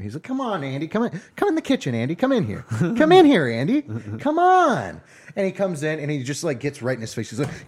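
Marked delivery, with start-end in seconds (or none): 0.2-5.0 wheedling coax. Retold or imitated. Chef wheedling his younger brother into the kitchen, sing-song. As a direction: lure him in with a lilting singsong.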